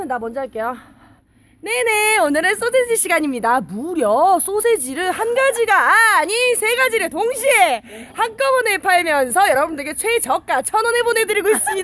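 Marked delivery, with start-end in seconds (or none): 1.6-11.9 home shopping host. Acted. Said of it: "홈쇼핑 버전".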